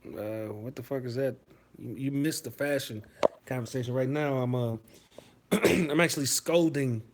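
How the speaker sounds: usual speaking voice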